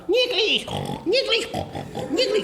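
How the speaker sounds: mock high flirting priest; falsetto come-on. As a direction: squeak it out like a lovesick old fool. Priest calls, pitched up.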